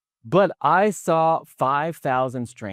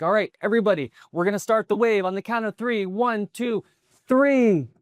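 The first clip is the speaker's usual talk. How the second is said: rallying the crowd; 9 semitones higher than usual. Retold or imitated. Kid starting the wave, excited high call. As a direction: call out to a whole section.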